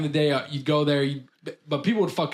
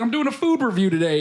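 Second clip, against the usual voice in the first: put-on Canadian accent; 7 semitones higher, 3 dB louder than usual. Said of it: "a very strong Canadian accent".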